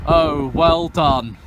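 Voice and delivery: slow, dripping sarcasm; sarcastic. Real, not acted. Sarcastic praise for delayed train.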